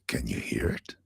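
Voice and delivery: low, near-whispered retold question; hushed question. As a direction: whisper it so she listens. Retold German man hushes her to listen.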